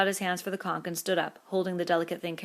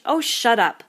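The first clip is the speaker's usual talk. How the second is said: loud, higher snap; savage; 6 semitones higher, 11 dB louder, slower than usual. A narrator's reading. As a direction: silence them, savagely. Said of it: "made him savage".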